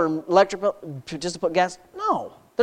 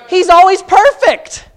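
preaching at full force; 9 semitones higher, 9 dB louder than usual. Acted. Preacher exalting God to the congregation, loud and high. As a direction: lift the room with it.

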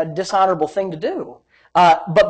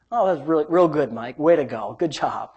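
sarcastic self-talk; 5 semitones lower, 5 dB quieter than usual. Retold or imitated.

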